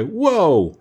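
high, drawn-out cry to halt; storyteller's contained yell, pitched well up; loud cry. A narrator's reading. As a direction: cry out to stop a horse, stretching the word. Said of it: "as loud as he could".

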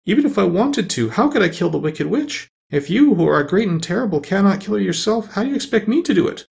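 put-on high, upset little girl's plea; tearful protest. A narrator's reading. Disappointed Dorothy argues with the great Oz, high and upset. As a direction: plead in a high voice, lightly tearful. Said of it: "she sobbed".

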